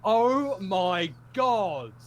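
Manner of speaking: imitated exasperation. Bank staff reacting behind the scenes, imitated exasperation. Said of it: "hear behind the scenes them going".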